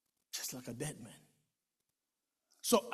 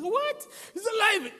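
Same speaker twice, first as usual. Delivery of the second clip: very high, astonished cry; shocked sister hearing he is alive; stunned disbelief; 13 semitones higher than usual. Retold or imitated. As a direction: gasp out the question.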